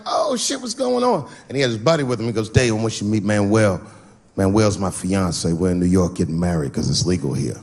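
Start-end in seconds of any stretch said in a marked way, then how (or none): 0.1-1.3 put-on high-pitched hello to an old friend; acted-out happy surprise; excited greeting. Acts out greeting friend backstage. 2.5-7.6 low-pitched voice of a friend making introductions; imitated buddy, pitched down; friend's voice. Voices friend introducing his fiance.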